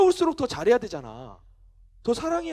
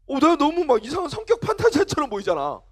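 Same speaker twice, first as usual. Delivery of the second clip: normal talking voice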